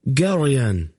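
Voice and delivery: high startled call of his name; exclaiming. A narrator's reading. Laldoran to Geryon seeing the prince, exclaiming. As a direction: exclaim in surprise. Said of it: "Laldoran exclaimed".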